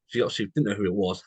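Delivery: ordinary delivery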